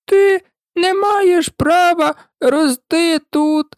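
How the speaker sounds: high, slow squeaky complaint; a tiny creature squeezed and grumbling; high and slow, crushed and grumbling; Dormouse voice. A narrator's reading. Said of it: "сказав вовчок".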